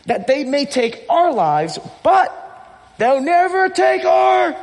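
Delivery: high, slow battle speech in a put-on British accent; rally the troops, drawn-out, building to a shout; William Wallace. Pastor playing Wallace rousing his army, British-accented battle speech. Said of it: "in his British accent".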